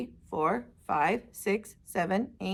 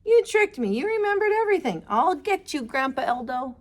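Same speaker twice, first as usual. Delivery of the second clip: playful shout; 4 semitones higher than usual. Acted. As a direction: shout after grandpa teasingly.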